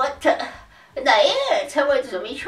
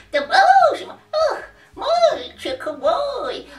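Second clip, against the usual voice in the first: put-on high, slow, laughing old wizard; Dumbledore voice; 12 semitones higher, 3 dB louder, slower than usual. Shown as a demonstration. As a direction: wheeze it out like a kindly old wizard. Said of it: "как у меня разговаривает Дамблдор".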